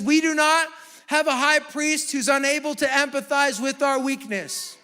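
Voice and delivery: loud, high, impassioned preaching, slowing on key phrases; full-force preaching. Acted. Pastor to the congregation, preaching at full force. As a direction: preach it out to the whole room, full voice.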